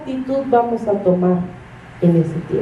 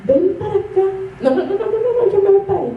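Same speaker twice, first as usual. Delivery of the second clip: put-on child refusing; 8 semitones higher, faster than usual. Child running from parent.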